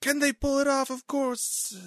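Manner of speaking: very high, squealing mock excitement; excited boastful general. General answering the president, wildly excited. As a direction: echo the question in giddy, over-the-top pride. Voicing Scott's boastful reply.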